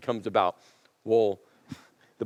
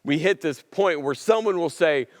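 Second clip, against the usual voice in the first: ordinary talk, nothing marked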